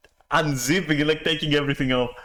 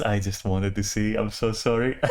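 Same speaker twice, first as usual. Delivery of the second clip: ordinary talk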